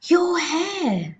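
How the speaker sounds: high, horrified mother's shriek. A narrator's reading. Mother pointing at her husband's hair, shrieking. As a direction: shriek it high as a shocked wife. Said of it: "the mother was shrieking".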